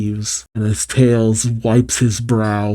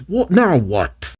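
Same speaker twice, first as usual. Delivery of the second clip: mock startled exasperation; shocked; 5 semitones higher, slower than usual. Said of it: "much to the shock of the Heavy King".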